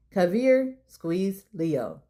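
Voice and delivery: usual speaking voice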